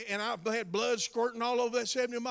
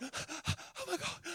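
slow, breathy, higher-pitched gasp of shock; 8 semitones higher, 8 dB quieter, much slower than usual. Retold or imitated. Pastors gasping at a casual greeting to the bishop, shocked. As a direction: gasp it as if scandalized. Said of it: "all these pastors like".

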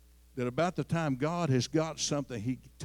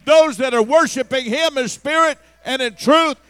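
louder, higher preaching, building; preaching at full force; 8 semitones higher, 14 dB louder than usual. Acted. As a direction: drive it home to the congregation.

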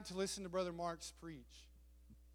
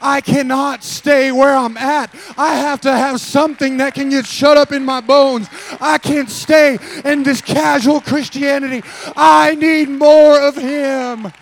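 loud, high, slowing cry for more of God; shouted prayer cry; 8 semitones higher, 30 dB louder, slower than usual. Retold or imitated. Voicing the hungry family, a loud high plea. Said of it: "they realize".

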